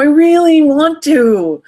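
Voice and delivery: put-on high, loud, slow kid plea; yearning child. Inner nine-year-old pleading, high slow yearning voice. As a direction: draw it out like a longing child. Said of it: "what the kid says".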